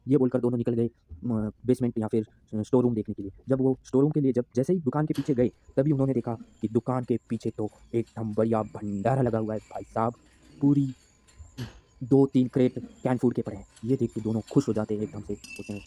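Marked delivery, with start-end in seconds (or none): none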